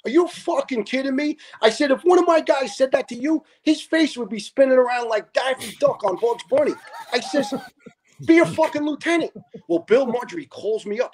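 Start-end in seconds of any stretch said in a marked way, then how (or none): 0.1-1.4 high-pitched, incredulous challenge; indignant retelling. Retold or imitated. Retelling how he told off a storming lieutenant, indignant. 1.9-6.7 high-pitched, worked-up mock threat; indignant retelling. Firefighter recounts threatening a lieutenant over his men, indignant. 8.2-9.3 high, exasperated comeback; indignant retelling. Retold or imitated. Recounting his rebuke to the storming lieutenant, indignant.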